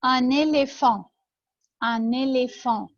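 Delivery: slow "Un éléphant" with both é's closed; drawn-out repeat-after-me "Un éléphant"; pronunciation model. Shown as a demonstration. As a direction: model "éléphant" slowly as ay-lay-fahn. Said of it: "ننطق كده الحروف دي مع بعض".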